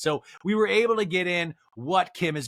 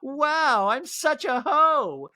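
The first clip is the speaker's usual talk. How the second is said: high mock gasp, then high and slow; high, slow mock shock of a celebrity; shocked squeal; 12 semitones higher, 3 dB louder, much slower than usual. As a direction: squeal it like you just caught yourself. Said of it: "And Chris is like".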